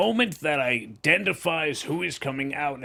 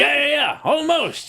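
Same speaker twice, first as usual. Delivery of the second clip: loud, high excited yelling; excited shout; 11 semitones higher, 6 dB louder than usual. Real, not acted.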